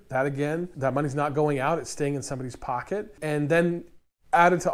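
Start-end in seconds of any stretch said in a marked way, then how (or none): none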